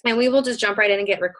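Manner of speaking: ordinary talk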